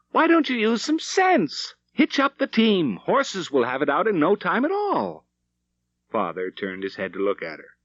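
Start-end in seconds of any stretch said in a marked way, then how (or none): 0.1-5.3 calling mother. A narrator's reading. Wife calling to her husband to hitch the team, high and exasperated. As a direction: urge him to use sense, much higher. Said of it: "Mother called".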